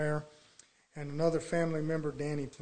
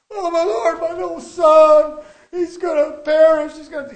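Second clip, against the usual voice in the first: very high, shouted parent's plea; imitating desperate cries for a child; mock frantic wailing; 11 semitones higher, 20 dB louder than usual. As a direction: wail loudly like a panicking parent, hammed up. Mimics parent wailing for son.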